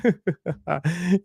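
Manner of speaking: God's mocking laugh; a high scornful chuckle. God laughing at the wicked.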